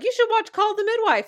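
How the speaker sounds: high-pitched, eager mock suggestion; chirpy imitated nurse. Nurse recommending a show to pregnant patient, chirpy imitation.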